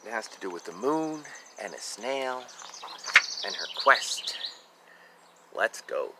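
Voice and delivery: plain reading voice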